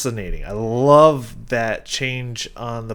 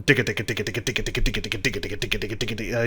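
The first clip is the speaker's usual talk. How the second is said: rapid-fire vocal imitation of a driving rhythm; steady, mechanical rhythmic patter; pumping rhythm; much faster than usual. Shown as a demonstration. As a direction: chatter the syllables like a galloping guitar. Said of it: "pumping energy rhythm".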